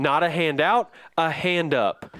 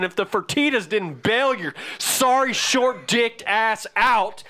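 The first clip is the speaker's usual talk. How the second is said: raised, heated tirade, pitched high throughout; furious rant; 5 semitones higher than usual. Real, not acted. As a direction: unload on him, voice climbing with rage. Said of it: "I thought I got wound up about shit".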